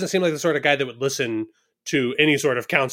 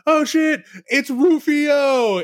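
high, loud, stretched-out cry of recognition; excited shout; 13 semitones higher, 4 dB louder, much slower than usual. Retold or imitated. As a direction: yell it out, thrilled to spot him.

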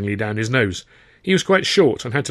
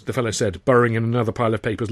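ordinary talk

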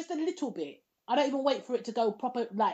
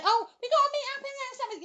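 imitating a friend; faster than usual. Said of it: "we keep saying".

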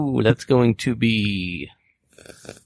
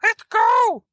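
high-pitched call to a companion; in-character yell. Acted. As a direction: yell it high, as if across a room. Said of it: "And I'm gonna yell".

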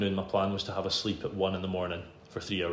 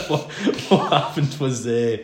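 words bubbling through a laugh; giggling through the opening words; 5 semitones higher, 10 dB louder, slower than usual. Real, not acted. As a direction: laugh as you start to tell it. Laughing while starting the crash story.

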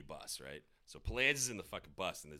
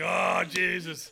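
mock dismay; 8 semitones higher, 10 dB louder, much slower than usual. Retold dismay.

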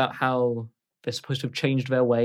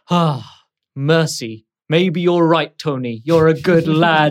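louder, gruff captain giving in; sighing captain; 3 semitones higher, 9 dB louder than usual. Acted. Captain sighs and praises Tony for mercy. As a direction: relent with a sigh, grudging praise. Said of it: "The captain sighs and he says".

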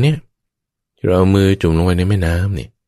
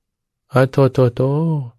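mock apology; 8 semitones higher, much slower than usual. Acting the sorry mind after scolding.

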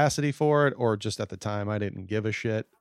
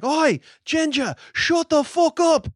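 loud, high, drawn-out Irish movie-quote yell; shouted Irish accent; 7 dB louder than usual. Acted. Quoting The Commitments at somebody being too loud, shouted Irish accent. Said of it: "from The Commitments".

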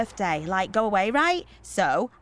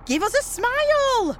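high, boisterous jollying along; loud rallying; 7 semitones higher, 5 dB louder, slower than usual. Acted. Organiser brushing off a worried mum, boisterous. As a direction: cut in loudly and rally everyone.

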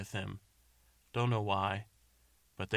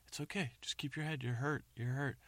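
higher, gentle soothing voice; soothing a scared dog; 6 semitones higher than usual. Acted. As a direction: coax him calm like a frightened animal. Friend comforts injured man.

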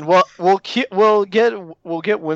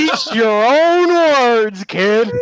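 gloating taunt; 8 semitones higher, 6 dB louder, much slower than usual. Pretend. Gloating over defeated opponent.

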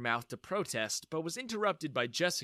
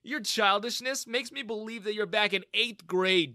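higher, taunting comeback; smirking; 7 semitones higher, 4 dB louder than usual. A narrator's reading. Young founder answering his bully back, smirking. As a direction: needle him with a smirk. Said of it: "I smirked".

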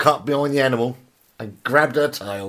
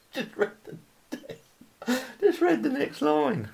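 soft, breathless laughing wheeze; helpless laughter; 13 semitones higher, 6 dB quieter than usual. Real, not acted. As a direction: struggle to speak through the giggles. Too tickled to keep reading.

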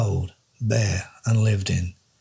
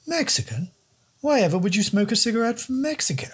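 high, plummy English don, scandalised; aghast posh don; 12 semitones higher than usual. Acted. The don appalled by the detective's Mexican cigarettes, high plummy voice. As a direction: pitch way up, appalled at the very idea.

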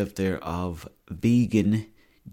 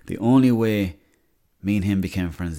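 ordinary talk

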